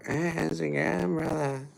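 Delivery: high, slow mock greeting; impression of the greeter. Shown as a demonstration. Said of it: "let's see if I can do it".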